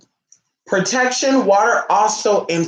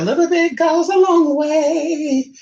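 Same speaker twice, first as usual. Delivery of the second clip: higher, slow, lightly voiced pop-star quote; a playful nod to the singer; mock celebrity voice, higher and slower; 10 semitones higher, slower than usual. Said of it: "[name] said it".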